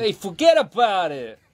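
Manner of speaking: loud, drawn-out Bronx wiseguy line; Bronx accent. Shown as a demonstration. Guest shows the host a Bronx accent on request, hammed up. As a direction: lay on a thick Bronx accent. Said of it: "Can you do a Bronx accent?".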